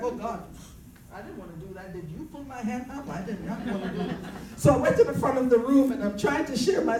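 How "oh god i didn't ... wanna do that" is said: a near-silent, dismayed hush with a small laugh; half-laughing, mortified, under her breath. Retold or imitated. Retelling her hand going up at the seminar, a mortified hush.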